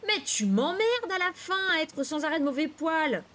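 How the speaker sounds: high-pitched, fed-up outburst; exasperated outburst. A narrator's reading. Fed-up sibling snapping at moody sister, exasperated outburst. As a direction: let the temper burst out at a sibling. Narrator loses temper at sister.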